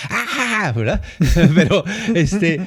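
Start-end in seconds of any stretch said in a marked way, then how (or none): 0.1-0.7 a short high yelp of exasperation; imitating a blow-up; mock yell. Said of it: "también hubiera dicho".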